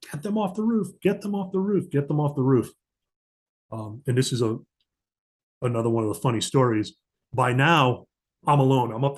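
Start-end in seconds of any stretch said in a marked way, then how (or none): 0.1-2.7 high-pitched mock scream at the boss's pitch. Ambassador's staff yelling down the line, high-pitched mock scream. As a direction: shriek it like a boss losing it. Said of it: "she started screaming at me".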